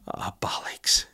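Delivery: hushed mock-dramatic curse; whispered dismay. Boss turning the page to bad information, dramatic whisper. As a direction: curse under your breath for the cliffhanger. Acted reaction to turned page.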